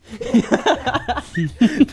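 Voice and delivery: loud, high burst of laughter; high, helpless laughing. Real, not acted. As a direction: crack up at your own example. Amused by own film example.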